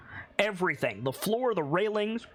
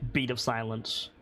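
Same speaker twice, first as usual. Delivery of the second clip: ordinary delivery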